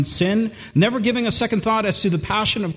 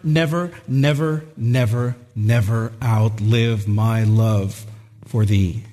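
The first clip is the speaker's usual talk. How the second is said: each never drops lower, last one low and slow; repeated never, sinking lower; 7 semitones lower, slower than usual. Acted. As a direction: let each never fall further down.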